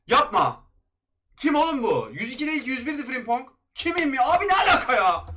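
loud, high cries of disbelief at the pack; frustrated outburst. Real, not acted. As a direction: shout it at the screen, outraged. Pack opening reveals wrong player. (paketten çıkan oyuncuya isyan eden tiz, yüksek sesli haykırış)

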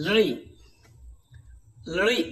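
"লড়ি" said as a short clear li, twice; Sanskrit li sound. Shown as a demonstration. Teacher to class, modelling Sanskrit "লড়ি". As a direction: repeat "লড়ি" crisp and short. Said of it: "সেভাবেই উচ্চারণ করব".

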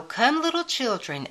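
usual speaking voice

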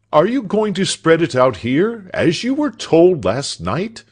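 high, loud reproving question; scolding master. A narrator's reading. Doctor scolds his servant.

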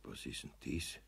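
tonlos geflüstert, erstaunt; flüstere verwundert vor dich hin; verwundertes Flüstern. Acted. Eder murmelt verwundert. (leise verwundertes Flüstern)

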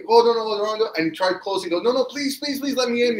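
high, pleading cries of no. Retold or imitated. Man begging at the locked door, high pleading.

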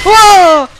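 short high shriek of fright; frightened scream. Real, not acted. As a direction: scream in sudden terror. Jumpscare in horror game.

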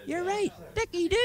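high, loud howling cry into a laugh; crazed howl. Acted. Paddy hollering agreement at his friend, howling then laughing. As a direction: whoop it out like a madman.

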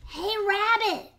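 high, loud, slow call; yelled. A narrator's reading. Visitor outside yelling for Rabbit. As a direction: call out to the house. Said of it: "someone yelled outside".

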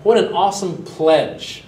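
hammed-up awe at a great vow; mock gushing praise. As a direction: gush like you are hugely impressed. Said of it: "All the different sermons said".

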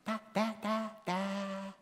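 put-on high, babbling baby voice; a dad imitating his baby's first words. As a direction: coo it like a toddler learning to talk. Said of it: "little baby daughter or son to go".

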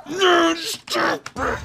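high, loud and slow; shrieking no. Real, not acted. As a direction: drag out a shrill stop it. Shrieking at the game.

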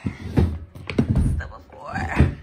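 breathy slow whisper. Shown as a demonstration. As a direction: whisper it slowly. Repeated take, whispered.

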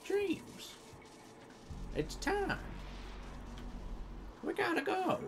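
very high falsetto calling; high silly voice. Pretend. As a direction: squeak it up high, teasing.

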